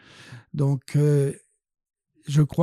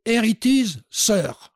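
higher-pitched, triumphant English announcement; triumphant handover; 5 semitones higher, slower than usual. Retold or imitated. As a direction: present it proudly to the professor. Hands work proudly to professor.

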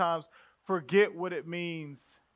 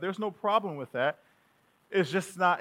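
ordinary delivery

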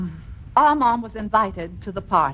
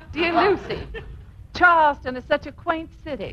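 higher, amused sing-song reading; mocking quote; 6 semitones higher than usual. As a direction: read his letter back with a laugh. Wife mockingly quotes husband's letter.

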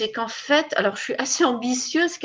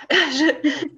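laughing, pitched higher and louder; nervous laughter; 7 semitones higher, 6 dB louder than usual. Real, not acted. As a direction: giggle through the stumble. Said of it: "je bafouille".

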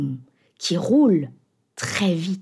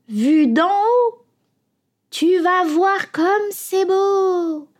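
coaxing seagull; 8 semitones higher than usual. A narrator's reading. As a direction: lure the bun like a sly hungry bird. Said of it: "Elle appelle".

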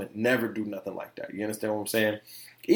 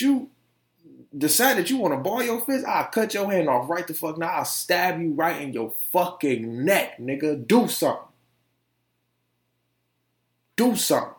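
retold threat; 6 semitones higher than usual. Threatening stepdad with a knife.

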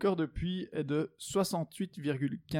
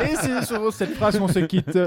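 laughing sign-off; 4 semitones higher, 11 dB louder than usual. Real, not acted. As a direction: wrap up while laughing. Wrapping up after a joke. (en riant)

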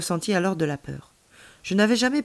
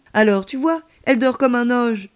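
ordinary delivery

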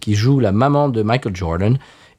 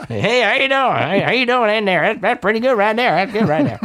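Larry the Cable Guy; 8 semitones higher than usual. Acted. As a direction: imitate a Southern US comedian, pitched high. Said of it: "Larry the Cable Guy".

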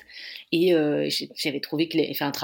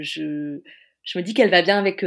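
normal talking voice

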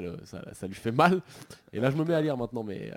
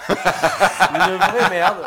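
laughing; 4 semitones higher, 8 dB louder, much slower than usual. Real, not acted. Laughing in disgust at the shower.

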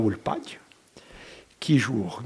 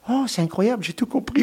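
higher, louder retold exclamation; imitated amazement; 10 semitones higher, 4 dB louder than usual. Host retelling her reply to his Corsican phrase, amazed. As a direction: act out her delighted astonishment. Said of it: "Vous savez ce qu'elle m'a dit?".

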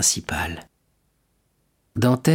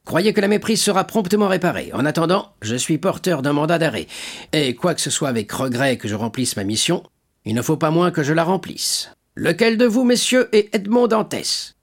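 high, formal voice of the law; stern commissaire; 7 semitones higher than usual. Acted. Commissaire annonçant un mandat d'arrêt, ton officiel. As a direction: announce the arrest like a stiff official.

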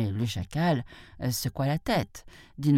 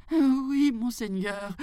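high, meek mock fright; timid jackal; 9 semitones higher, slower than usual. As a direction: answer meekly in a high voice. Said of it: "faisant semblant de trembler de peur". (chacal craintif, voix aiguë)

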